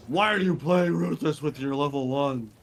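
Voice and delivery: mocking, put-on complainer voice; imitated chat troll. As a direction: mimic an annoying chat commenter. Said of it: "and be like".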